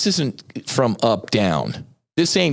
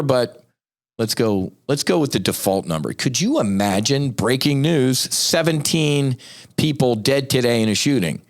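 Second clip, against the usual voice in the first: ordinary talk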